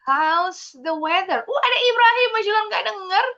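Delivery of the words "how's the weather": "How's the weather" slow, falling at the end; falling intonation model. Shown as a demonstration. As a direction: model "weather" dropping, for repeating. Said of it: "Repeat after me". (intonasi turun di akhir "weather", pelan)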